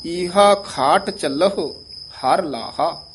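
slow, with a break before ਹਰ ਲਾਹਾ; phrased recitation. Shown as a demonstration. Teacher shows the phrasing of the line. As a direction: split the line in two. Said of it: "ਈਹਾ ਤੇ ਯਮਕ ਲਾ ਦੇਣੀ ਹੈ".

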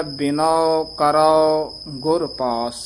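very slow ਬਿਨੋ ਕਰੋ, with a clear u sound in ਕਰੋ; careful ਬਿਨੋ ਕਰੋ. Shown as a demonstration. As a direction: say ਕਰੋ with the aunkar, slowly. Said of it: "ਊੜਾ ਬੰਦ ਮੂੰਹ ਵਾਲਾ ਔਂਕੜ ਹੈ".

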